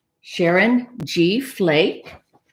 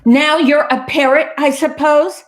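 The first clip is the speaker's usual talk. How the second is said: high, loud sarcastic question; exasperated, pitched-up retort; irritated cat; 8 semitones higher, 6 dB louder than usual. Acted. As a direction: challenge the copycat crossly. Irritated cat challenges copying duck.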